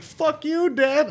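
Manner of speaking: high-pitched imitation of a child; cheeky kid. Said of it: "So they're like".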